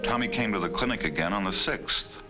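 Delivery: ordinary delivery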